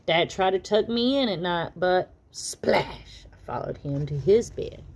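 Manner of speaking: usual speaking voice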